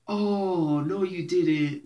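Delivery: drawn-out, scandalised disbelief; slow mock-horrified reaction; mock shock. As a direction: imitate an appalled listener, stretching it out. Imitating listeners shocked by the story.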